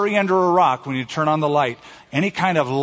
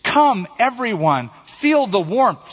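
high, eager call to everyone; inviting; 8 semitones higher, 4 dB louder, slower than usual. A narrator's reading. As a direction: call the crowd over, pitched up. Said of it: "she invited".

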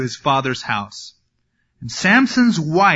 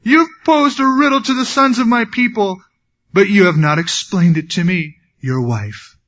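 fake weeping wife; 5 semitones higher, 3 dB louder than usual. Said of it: "and this is all fake".

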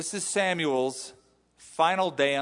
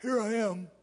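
high, light put-on boy's voice; boy Samuel answering; 7 semitones higher than usual. Boy Samuel answering God's call, in a high boy's voice. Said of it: "called him as a boy".

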